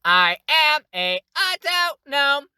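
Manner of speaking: high, slow, sing-song robot line. Shown as a demonstration. Tutor demoing the memorable autognome voice, sing-song. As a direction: hop from note to note like a gnome automaton. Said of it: "it creates a really memorable".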